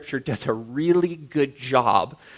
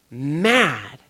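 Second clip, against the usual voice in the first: usual speaking voice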